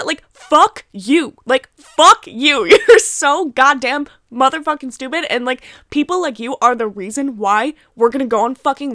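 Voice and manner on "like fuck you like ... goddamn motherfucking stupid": slow, loud, furious cursing. Real, not acted. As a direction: hurl it at the spring breakers.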